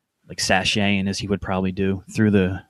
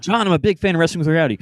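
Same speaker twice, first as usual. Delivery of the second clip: quick, keen fan voice, a little raised; eager fan; 6 semitones higher, faster than usual. Pretend. A pretend fan gushing at John, fast and eager. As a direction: gush at him like a starstruck fan.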